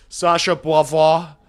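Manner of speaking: Beauvoir said the French way, bwah-VWAH; French pronunciation. Shown as a demonstration. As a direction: say Beauvoir with a French accent, stress on the last syllable. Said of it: "Whatever you Frenchies".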